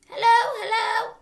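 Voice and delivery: high, loud squawky parrot voice; parrot calling. Acted.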